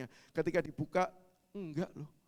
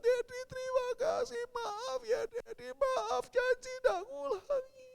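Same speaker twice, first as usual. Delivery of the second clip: very high, drawn-out tearful thanks; mock grateful weeping kid; imitated sobbing child; slower than usual. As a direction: promise through childish tears. Forgiven child clings, crying.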